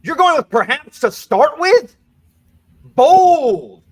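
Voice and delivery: loud, high game-show host surprise; impressed host exclaiming; excited host. Real, not acted. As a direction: hype up the bold choice. Host reacting to bold pick.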